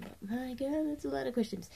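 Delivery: usual speaking voice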